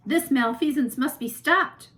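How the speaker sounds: janitor-turned-superhero proclaiming; superhero declaration. A narrator's reading. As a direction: declare it like a comic hero. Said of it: "always said before he was transformed".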